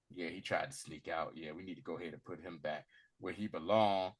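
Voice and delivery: low, hushed, matter-of-fact mock dialogue; offhand elves. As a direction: play it down, quiet and low. Elves' casual remark, acted.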